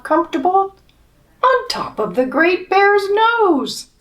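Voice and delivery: put-on lively read-aloud for children; storybook reading. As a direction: tell it like a bedtime picture book. Reading picture book to children.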